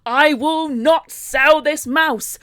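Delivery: slow declamation building to a loud roar; hammed-up, drawn-out outrage; irate. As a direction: thunder it word by word like a vow. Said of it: "quite irate by this".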